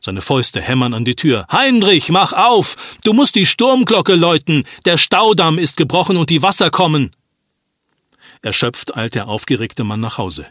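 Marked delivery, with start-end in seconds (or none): 1.5-7.2 loud, high, desperate shouting; father pounding on the door, shouting in alarm; urgent shout. Acted. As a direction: yell through the door to wake him. Fists hammering on sexton's door.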